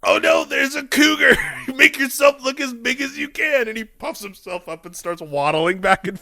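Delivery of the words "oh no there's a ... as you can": high, loud panicked Peter. Retold or imitated. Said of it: "you just hear Peter from off screen go".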